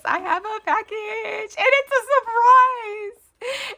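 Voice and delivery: high, loud squealing announcement; excited squeal. Real, not acted. Vlogger to viewers, squealing excitedly about a package.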